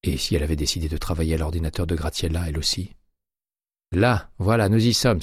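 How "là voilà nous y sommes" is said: higher, louder triumphant cry; exclamation. A narrator's reading. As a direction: call it out as she gets there. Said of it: "s'écria celle-ci". (exclamation, plus aigu et plus fort)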